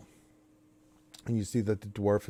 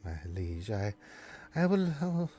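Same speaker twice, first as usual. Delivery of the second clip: meek elf; 2 semitones lower, 8 dB quieter than usual. Acted. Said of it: "the elf's like".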